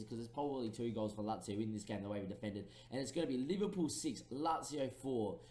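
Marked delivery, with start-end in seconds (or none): none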